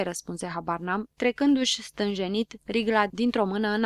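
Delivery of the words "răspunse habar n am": usual speaking voice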